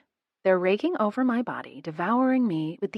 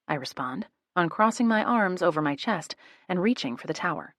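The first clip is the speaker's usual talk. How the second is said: ordinary delivery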